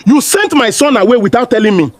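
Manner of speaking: fast, heated reproach; acted angry father demanding answers; raging husband. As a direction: confront your wife, quick and angry. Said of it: "he raged".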